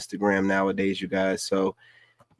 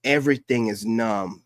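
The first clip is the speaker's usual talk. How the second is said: normal talking voice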